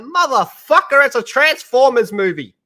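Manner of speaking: loud, high exasperated yell; exasperated outburst. Retold or imitated. Reacting to the director, a loud exasperated yell. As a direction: blow up at the movie like it's obvious. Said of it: "I'm like".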